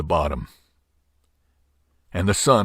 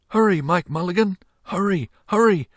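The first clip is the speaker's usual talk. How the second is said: high, urgent shouted plea; shouting boy; 11 semitones higher than usual. A narrator's reading. Little boy shouting to Mike Mulligan, high and urgent. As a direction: urge the digger on like an eager boy. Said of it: "shouted the little boy".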